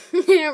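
a sudden loud real laugh; burst of laughter. Real, not acted. Laughs at a mix-up.

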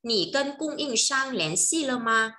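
Chinese question said slowly and clearly; slow model sentence. Shown as a demonstration. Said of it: "câu này sẽ nói là".

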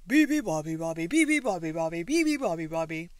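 very loud roared chant; roaring animals. A narrator's reading. Animals keeping the bird awake, loud roaring. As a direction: roar the bird's song without letup. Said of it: "the animals roared".

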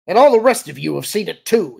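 high, loud outburst to everyone; shouted accusation. A narrator's reading. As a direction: shout it out at the whole room. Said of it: "he shouted".

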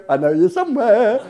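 sing-song call while laughing; teasing the hidden snakes, laughing; playful calling out. Retold or imitated. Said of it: "walk around going".

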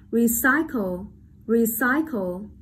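recycle slowly with CY stressed; light re, then lean on CY in recycle; slow, careful model of recycle, middle syllable strongest; stress on second syllable. Shown as a demonstration. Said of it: "đánh trọng âm rơi vào âm tiết thứ hai".

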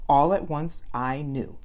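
plain reading voice

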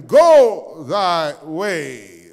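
low, very slow solemn command; solemn Jesus. A narrator's reading. Jesus sending the healed man off, solemn slow voice. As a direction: let each word land with weight. Preacher voicing Jesus slowly.

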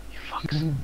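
whispering. Real, not acted. Muttered insult at game.

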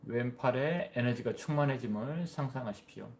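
measured body-scan guidance with long pauses; guided meditation. Real, not acted. (차분히 이끄는 명상 안내)

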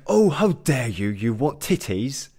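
high, loud, indignant British scolding; over-the-top British accent. Acted. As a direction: scold in hammed-up British outrage, dragging the ending. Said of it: "a little bit too extra in the British accent".

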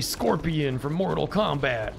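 lightly growled fighter voice, slow; a hint of the Mortal Kombat ninja; Scorpion voice. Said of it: "Doing a normal Scorpion voice".

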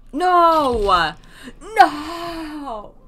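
high, loud anguished no; loud half-laughing protest; loud wailing no. Real, not acted. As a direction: wail it in mock despair. Wails at losing party.

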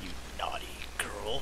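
fast whispered tease; sexy whisper. Acted. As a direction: hiss it out playfully. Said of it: "he told her sexily".

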